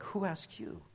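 mock indignant challenge, pitched a bit up; lightly acted scornful retort; echoing scornful king. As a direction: imitate a dismissive crowd rebuffing a stranger. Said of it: "echo Amaziah and his crew".

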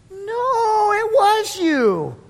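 put-on high, slow, whiny child voice; little girl accusing. Little girl insisting to Peter, whiny accusation. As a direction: wail the accusation, dragging it out. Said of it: "A little girl walks up to him".

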